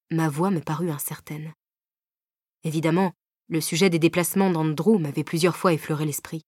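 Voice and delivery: plain reading voice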